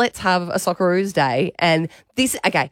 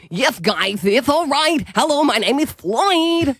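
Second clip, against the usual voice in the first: lightly voiced dog with a New York lisp, slow; a hint of a lisping New York husky; 6 semitones higher, slower than usual. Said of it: "a New York, um, lisp".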